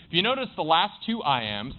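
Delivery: ordinary talk, nothing marked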